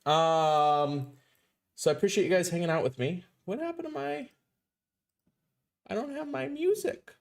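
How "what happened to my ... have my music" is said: high whiny complaint, softer; pitched-up whimper of complaint. Real, not acted. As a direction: whine about the missing music. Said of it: "hear my whiny voice".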